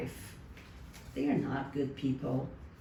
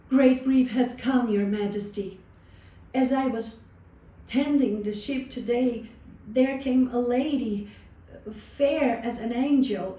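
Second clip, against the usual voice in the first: loud, higher, pleading confession; anguished confession; 5 semitones higher, 5 dB louder than usual. A narrator's reading. Shepherd confesses to the king at his feet, loud anguished plea. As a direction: throw yourself on his mercy.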